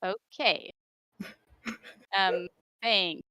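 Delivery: usual speaking voice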